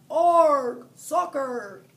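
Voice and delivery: very loud pirate roar; captain kicking the ball, roaring; yelled pirate cry. A narrator's reading. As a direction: bellow it as he kicks.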